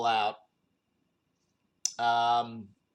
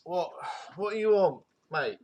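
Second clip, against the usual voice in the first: groggy grumble; 7 semitones higher, 3 dB louder than usual. Acted. Vampire woken groggily.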